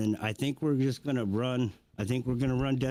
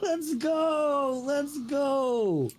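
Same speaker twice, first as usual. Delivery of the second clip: high excited cheering; much slower than usual. Real, not acted. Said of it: "You are really excited about this".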